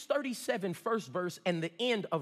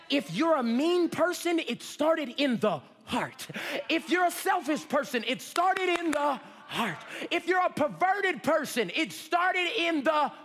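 raised, rhythmic preacher cadence; impassioned preaching; 6 semitones higher, 3 dB louder than usual. Real, not acted. Preacher tracing faults back to the heart, impassioned. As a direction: drive each parallel line home with force.

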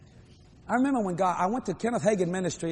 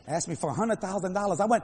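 normal talking voice

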